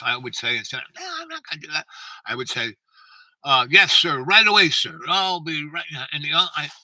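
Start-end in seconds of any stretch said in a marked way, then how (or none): 0.9-1.9 mock whiny refusal. Mock defiant child's reply. 3.7-5.8 loud, high-pitched yes-sir reply; acted-out snap to attention for a colonel; mock dutiful recruit; eager military obedience.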